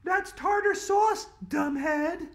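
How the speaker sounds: put-on very high, loud bossy little girl; girl corrects a classmate, high and bossy; snooty know-it-all girl. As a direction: squeak it out, correcting him with scorn. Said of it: "said Andrea".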